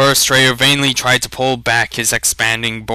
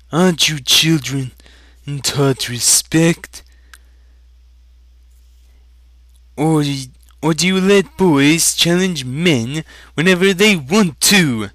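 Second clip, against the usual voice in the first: put-on raised, sneering rebuke of a boy; condescending thin traitor; 6 semitones higher, 3 dB louder than usual. Thin traitor scolding Aragorn before the villagers, sneering. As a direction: talk down to him with contempt.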